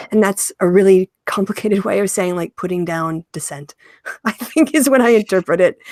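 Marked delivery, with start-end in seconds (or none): 4.0-5.8 laughing through the words. Real, not acted. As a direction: chuckle while speaking. Laughs at own paraphrase.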